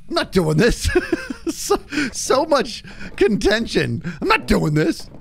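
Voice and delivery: high voice broken by laughter; laughing protest. Real, not acted. Streamer cracking up over the group's bickering, laughing protest.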